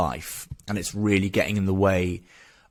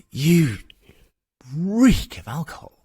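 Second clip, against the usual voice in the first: appalled accusation; 8 semitones higher, 3 dB louder, slower than usual. Retold or imitated. Retold counsellor's appalled accusation.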